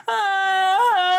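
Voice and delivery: high loud mock yell; mock scream. Guy in the hospital screaming, loud mock yell. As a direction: let out a hammed-up scream. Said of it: "just screaming".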